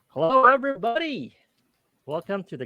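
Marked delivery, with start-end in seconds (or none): none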